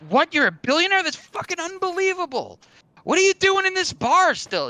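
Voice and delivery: can't believe your ears; amazed disbelief. Acted. Guy amazed at billionaire.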